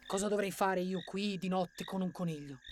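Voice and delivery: soft, unimpressed prince; scoffing elf. A narrator's reading. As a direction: grumble at being left with a rabbit.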